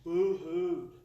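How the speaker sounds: boo-hooed stretched out slowly; a slow, heavy boo-hoo; slow drawn-out boo-hoo. Real, not acted. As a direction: let "boo-hooed" sag out long and slow. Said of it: "that's like an Oklahoma term".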